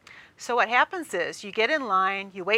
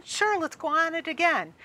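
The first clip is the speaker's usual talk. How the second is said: eager agreement; 7 semitones higher than usual. Retold or imitated. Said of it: "And then you decide".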